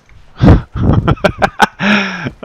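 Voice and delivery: loud, high burst of laughter; real laugh. Real, not acted. Player delighted with his gun, loud high laugh. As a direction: cackle out loud, pitch climbing.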